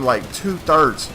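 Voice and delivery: slow, emphatic "two-thirds"; stress on two-thirds. Real, not acted. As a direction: lean hard on "two-thirds", stretching it. Stressing how much was cut.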